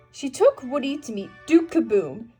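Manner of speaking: plain reading voice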